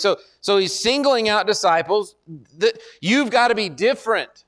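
normal talking voice

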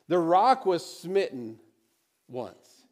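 pause, then let "once" fall; slow build to a hushed "once"; dramatic pause on once. Shown as a demonstration.

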